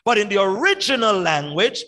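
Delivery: ordinary talk, nothing marked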